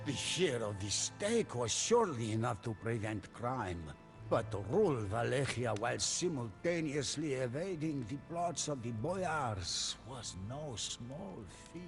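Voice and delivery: plain reading voice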